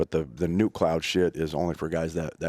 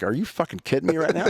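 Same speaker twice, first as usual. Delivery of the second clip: incredulous; 10 semitones higher than usual. Retold or imitated. Designer's reaction to his request.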